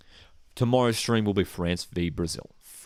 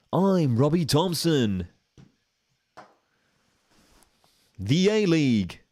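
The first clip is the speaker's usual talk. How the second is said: higher, slow English commentator voice; a hammed-up English football announcer; Robbie Thompson impression; 7 semitones higher, 3 dB louder, much slower than usual. Mimicking an English commentator for a mate, higher and slow. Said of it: "that's a terrible Robbie Thompson impression".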